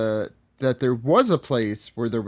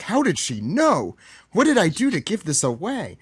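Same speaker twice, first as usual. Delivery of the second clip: high-pitched, incredulous mock dismay; hammed-up astonished protest; baffled alarm; 7 semitones higher than usual. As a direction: wail it, pitch swooping up. Acting out Luffy's baffled alarm.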